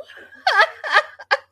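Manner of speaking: high, loud burst of laughter. Real, not acted. Laughing at her own toxic joke, high burst of laughter. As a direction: cackle at your own joke.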